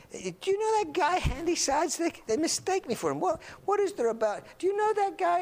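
high, put-on voice of the friend; go-between friend. Friend sounding out a girl about Handy Sides, high put-on voice. Peter relaying question on his behalf.

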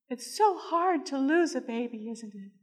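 soft high voice, fading at the end; small sympathetic chaplain. Retold or imitated. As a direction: console gently in a little high voice.

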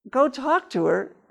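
high, slow disbelieving echo; incredulous. Retold or imitated. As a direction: repeat it like it's crazy. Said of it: "incredulous echo of the command".

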